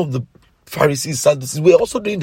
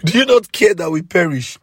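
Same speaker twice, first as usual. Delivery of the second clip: high, loud cry to the Lord; modern-English shouted plea; 4 semitones higher, 4 dB louder than usual. Retold or imitated. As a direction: plead loudly, in plain modern words. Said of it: "Let me not talk like the King James Version".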